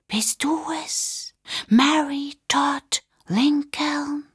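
high, slow, unvoiced spooky whisper; ghostly whisper. Michael in the closet whispers like a ghost to Rachel. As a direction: draw every word out like a haunting. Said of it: "gespenstisches Flüstern".

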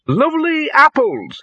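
very high, slow sing-out; street vendor's cry. A narrator's reading. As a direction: hawk it like a market trader. Dean mimics a street vendor.

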